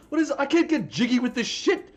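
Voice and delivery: high, loud, put-on furious yell; mock angry customer. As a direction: storm in yelling about a cracked CD.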